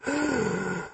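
high gasp for air; exhausted gasp. Acted. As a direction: suck in air after the fight. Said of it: "gasping for air".

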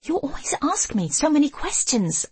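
high, heated accusation; voiced hiss of irritation, pitched high; angry hiss. A narrator's reading. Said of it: "I hiss".

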